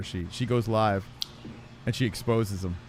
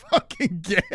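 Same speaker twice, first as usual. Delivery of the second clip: laughter breaking into the words; cracking up; 5 semitones higher than usual. Real, not acted. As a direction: burst out laughing mid-sentence. Cracks up at the discovery.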